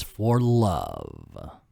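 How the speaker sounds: very low, drawn-out villain voice; deep, slow, ominous answer; villain Ernie. Acted. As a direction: drop deep and stretch it out.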